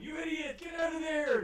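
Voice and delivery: high-pitched frantic yell; acted yelling. Yuan-Ti all yelling at their companion, high acted shout. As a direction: yell it high, as an angry crowd. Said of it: "are all yelling".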